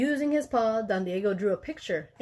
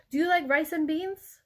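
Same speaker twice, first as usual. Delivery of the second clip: character voice; 7 semitones higher than usual. A narrator's reading. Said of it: "asked Pintolito".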